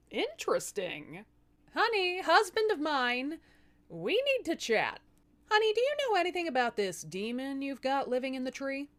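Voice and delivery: sweet on top, a threat underneath; mock sweet. Wife confronts husband sweetly.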